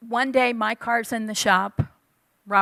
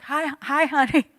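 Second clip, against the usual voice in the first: sheepish husband; 4 semitones higher than usual. Retold or imitated. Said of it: "this little look".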